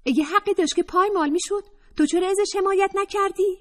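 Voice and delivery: put-on high, fast, challenging young woman; Atefeh's voice. Atefeh challenging Raheleh, high and fast. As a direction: fire the question back high.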